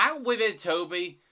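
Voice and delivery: high, loud cutting-in; impatient interruption. A narrator's reading. Thomas interrupts stammering Toby, impatient. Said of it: "Thomas interrupted".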